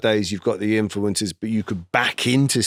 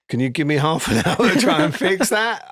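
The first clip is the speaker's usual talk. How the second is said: laughing plea; 6 semitones higher than usual. Retold or imitated. Writer asks for time, laughing.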